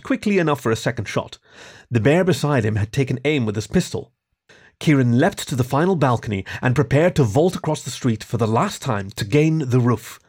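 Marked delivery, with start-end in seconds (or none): none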